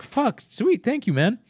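high, happy burst of thanks; pleased yelp of gratitude; delighted friend taking a check; excited thanks. Pretend.

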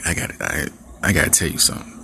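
nervous mumbled confession; mock hushed boyfriend. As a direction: stammer it out quietly.

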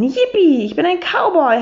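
high excited cheer; gleeful whoop. Acted. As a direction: whoop like a happy cowboy. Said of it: "hat er gerufen".

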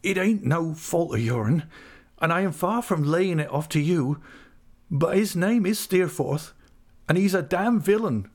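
high, anguished rustic voice; distressed countryman, pitched high throughout; broken-voiced Ham. A narrator's reading. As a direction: plead and accuse in heartbroken country dialect. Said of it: "in a broken voice".

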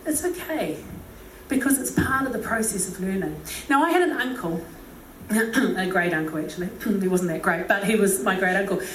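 ordinary talk

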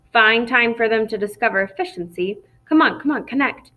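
plain reading voice

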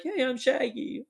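high-pitched cartoon slacker imitation; Shaggy. Retelling an actor cheering up a scared girl, high Shaggy voice. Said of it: "doing the Shaggy voice and stuff".

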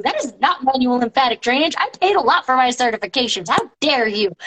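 normal talking voice

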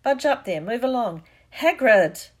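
boisterous holler along the row; calling out. Acted. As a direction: call out to shift people over. Hagrid squeezing into the stand.

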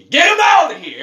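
high, loud put-on holler; mock dismissive shout. Host booting out lost listeners, mock shout. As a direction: throw them out with a showy yell.

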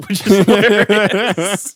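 slow words broken up by real laughter; laughing through it, high and loud. Real, not acted. To a friend, cracking up over the Colin Hanks scene, laughing. Laughing at own funny story.

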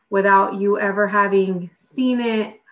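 ordinary delivery

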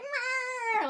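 high mocking meow; a high, catty meow to mock her; mocking the actress's catty growl. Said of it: "she's like".

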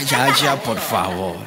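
low, different voice begging them to stop; mock pleading. Host to laughing crowd, low mock pleading voice.

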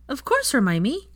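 put-on high, drawn-out childish assurance; emphatic. A narrator's reading. Teddy forgiving Hermione at once, emphatic. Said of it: "said Teddy emphatically".

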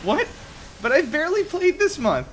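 high, loud shocked whine; hammed-up disbelief; outraged gamer. Acted outrage at ISP.

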